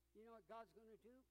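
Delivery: ordinary delivery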